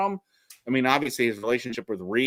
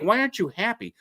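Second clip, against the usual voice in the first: imitating wife; 10 semitones higher than usual. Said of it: "She's like".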